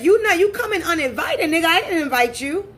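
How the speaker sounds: acting out a scolding of an unwelcome visitor; indignant scolding. Acted scolding of uninvited visitor.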